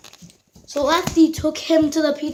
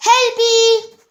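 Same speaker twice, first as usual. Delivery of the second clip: high, loud story call; sudden high-pitched cry for attention; 10 semitones higher, 5 dB louder than usual. Acted. Ghostly voice calls out to Helpy.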